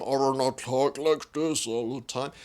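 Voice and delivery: a second unnatural voice, not her own; other put-on voice. Shown as a demonstration. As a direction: switch to a different forced speaking voice.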